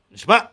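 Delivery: نشبع high and loud on the last syllable; نشبع rising to an abrupt throaty stop; sounded final ayin. Shown as a demonstration. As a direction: hit the guttural ending of نشبع. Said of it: "עין תורה".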